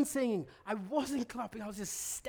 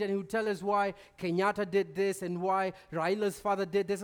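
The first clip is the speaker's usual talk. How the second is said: ordinary delivery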